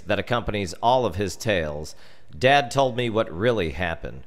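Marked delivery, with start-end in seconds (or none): none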